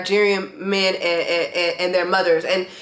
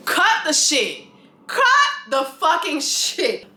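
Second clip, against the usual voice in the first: slow, higher, fed-up dismissal; exasperated; 6 semitones higher, slower than usual. Real, not acted. Talking back at Raven on the show, slow and exasperated. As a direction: tell her to drop the act. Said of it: "It's like, girl".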